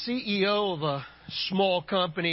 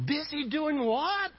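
puzzled boss; 7 semitones higher than usual. Acted. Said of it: "the boss asked the child".

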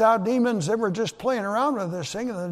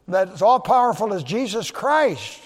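normal talking voice

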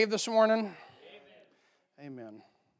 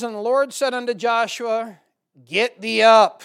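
loud booming voice of the Lord; scripture reading; 5 semitones higher, 12 dB louder than usual. Acted. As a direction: thunder "Get thee up" from the pulpit. Preacher reading scripture aloud.

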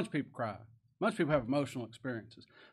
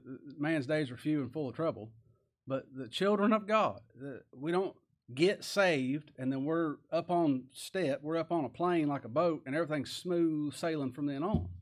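ordinary talk

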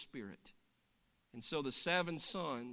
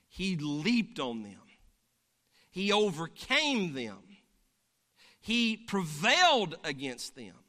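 rising sermon fervor; preaching; 4 dB louder than usual. Acted. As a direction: build it like a preacher. Preaching build-up.

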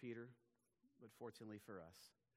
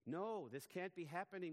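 denying; 7 semitones higher, 5 dB louder than usual. Acted. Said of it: "Peter says".

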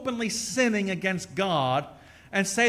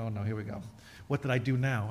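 low, much softer dread, like bracing for trouble; mock dread; 9 semitones lower, 7 dB quieter, faster than usual. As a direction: mutter a dreaded oh no under your breath. His reaction to angry wife.

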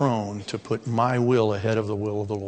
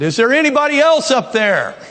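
high, loud, panicked plea from the rope; desperate cry for help; 12 semitones higher, 6 dB louder than usual. Acted. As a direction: hang on and yell up to heaven in panic. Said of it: "then said".

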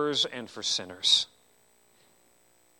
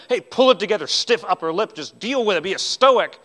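mock tough-love scolding, slightly raised; mock harsh; 3 semitones higher, 6 dB louder than usual. Telling a weeping woman to cope, mock harsh. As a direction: imitate someone harshly telling her to toughen up. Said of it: "not just a harsh, like".

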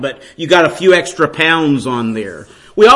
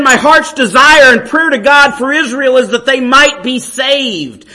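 higher and louder, pleading preacher's build; preaching at full force; 7 semitones higher, 3 dB louder than usual. Acted. Preacher voicing Paul's longing to save some, impassioned full-force preaching.

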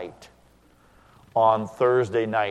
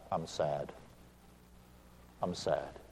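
much softer, subdued admission; quiet sadness; 5 semitones lower, 15 dB quieter, slower than usual. Retold or imitated. Son tells wife on the phone he's grieving, in a much softer, sad voice. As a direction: let it sink almost to nothing. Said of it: "I got very quiet, very sad".

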